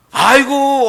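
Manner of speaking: high, loud "aigo" of relief; host rushes out to greet a guest; eager exclamation. Acted. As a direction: burst out in welcome. Host exclaims to real scholar.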